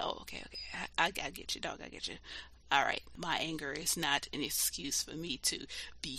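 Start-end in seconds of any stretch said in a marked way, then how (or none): 0.0-2.2 soft, half-voiced mock apology; quietly conceding, a touch breathy and deflated; sheepish backing down. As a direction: mumble it like you just got checked by a friend. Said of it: "Be like".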